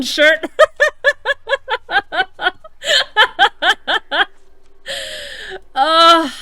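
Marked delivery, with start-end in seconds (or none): 0.5-5.7 long high cry-laugh; wheezing laughter. Real, not acted. Streamer laughing at the shredded shirt, high wheeze. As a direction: laugh helplessly.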